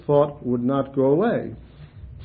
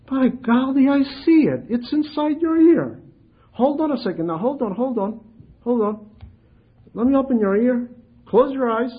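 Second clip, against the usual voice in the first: high, animated play-acting; playful pretend discovery; 9 semitones higher than usual. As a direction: play along with the joke, excited. Elder plays along examining ear.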